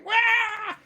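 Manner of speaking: high yelped "Wah!" in mock fright; retold scream. Boy retelling his brother's scream of fright. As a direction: squeal it like a scared kid. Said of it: "I just heard a scream".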